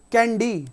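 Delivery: कैंडी with final Y as long EE; long E ending. Shown as a demonstration. Teacher giving an example, कैंडी ending in double E. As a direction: stretch the end of कैंडी into dee. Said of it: "वाई का sound double ई का निकलता है".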